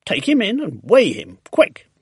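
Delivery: loud, urgent command; urgent order. A narrator's reading. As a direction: send them off in a hurry. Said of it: "Mister Hoppy ordered".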